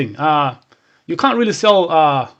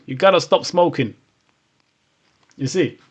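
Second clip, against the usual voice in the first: usual speaking voice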